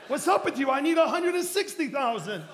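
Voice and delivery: high, loud comic protest; exasperated complaint. Retold or imitated. Pastor pleading with his Father over money, high exasperated protest. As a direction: demand an answer in mock outrage. Acted complaint to God continues.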